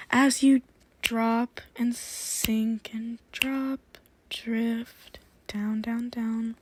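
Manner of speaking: breathy hypnotic guidance; whispered hypnosis. Acted. Sends listener back down.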